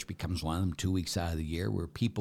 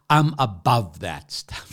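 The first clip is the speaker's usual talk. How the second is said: haughty woman; 4 semitones higher, 10 dB louder, slower than usual. Retold or imitated. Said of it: "she said".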